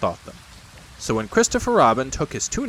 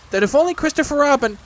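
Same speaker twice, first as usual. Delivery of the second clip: high, loud little-animal voice; Piglet butting in; 5 dB louder, faster than usual. Acted. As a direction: pipe up, cutting in high. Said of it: "put in Piglet".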